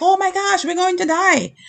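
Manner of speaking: very high, hammed-up panic; mock freak-out. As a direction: squeal it like the terrified disciples. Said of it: "they were freaking out".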